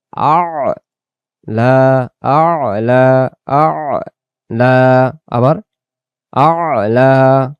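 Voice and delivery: আ'লা drawn out long with the throaty আইন; correct Arabic আ'লা. Shown as a demonstration. Teacher showing the correct আইন sound, drawn out. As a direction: stretch আ'লা with a throat catch. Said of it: "আবার বলেন আ'লা".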